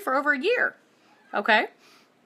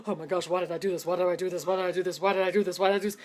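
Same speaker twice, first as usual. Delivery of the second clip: mock panic; 5 semitones lower, much faster than usual. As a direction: repeat it like you regret getting on. Panicking on roller coaster climb.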